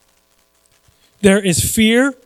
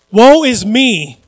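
loud, drawn-out lament; crying out; 3 semitones higher, 7 dB louder, much slower than usual. Acted. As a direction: cry it out slowly, louder. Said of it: "Look at what he cries out".

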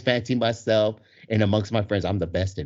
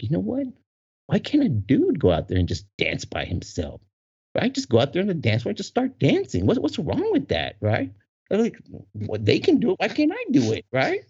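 rising higher into indignant disbelief; building excitement; 9 semitones higher than usual. Retold or imitated. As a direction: work yourself up to a peak. Inner rant, building.

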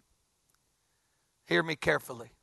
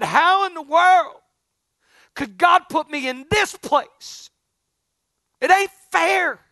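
high, slow, loud cry of unfairness; a retold lament, loud and drawn out; 1 anguished plea; 9 semitones higher, 7 dB louder, slower than usual. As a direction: wail it out to God, slow and pleading. Retold anguished complaint to God.

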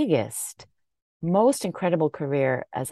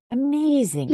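gushing; 8 semitones higher than usual. Real, not acted. Gushing over guest's energy.

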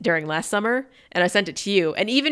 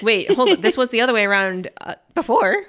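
louder, higher baffled voice; confused; 5 semitones higher, 4 dB louder than usual. Retold or imitated. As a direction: sound thrown and confused. Retold confusion.

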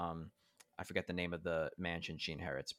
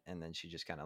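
ordinary delivery

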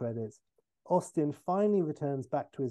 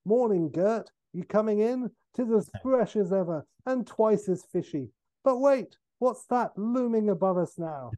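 high, loud, chirpy greeting turning to alarm; Ed Helms; 7 semitones higher, 4 dB louder than usual. Acted. Man popping out of icy water, calling up brightly. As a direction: play a hearty swimmer calling up. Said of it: "and it's Ed Helms".

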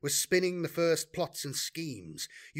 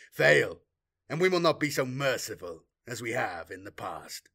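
menacing warlord. Acted. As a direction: lay out the task, then threaten. Warlord addresses sorcerer.

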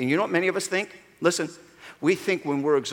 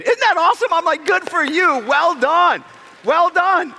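higher, louder cheering; excited praise; 12 semitones higher, 5 dB louder than usual. Real, not acted. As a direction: cheer them on.

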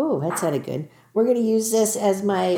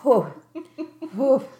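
after a shot of liquor; 5 semitones higher than usual. Real, not acted. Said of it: "My throat is on fire".

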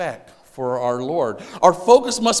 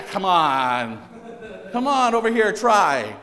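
chirpy trade show pitch; 3 dB louder, slower than usual. Retold or imitated. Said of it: "They, they get you there".

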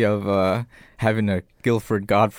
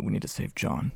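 creepy whisper; 7 semitones lower, 9 dB quieter than usual. Retold or imitated. Imitating Jigsaw voice.

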